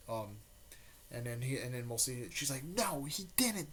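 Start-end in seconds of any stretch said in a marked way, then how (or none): 2.6-3.7 high, slow pained denial; a girl refusing to believe her teacher fell, heartbroken; emotional denial. A narrator's reading. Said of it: "Naruto there is feeling this emotion".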